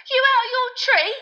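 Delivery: very high, loud startled yelp; alarmed yelp. A narrator's reading. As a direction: shriek it in shocked disbelief. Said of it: "yelped Stan".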